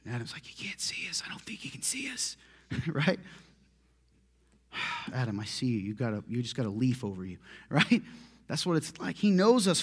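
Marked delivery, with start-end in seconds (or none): 0.5-2.4 whispered, hushed and hidden; Adam whispering from his hiding spot. Retold or imitated. 5.1-7.4 1 God, amused and gentle. Retold or imitated. God answering hiding Adam.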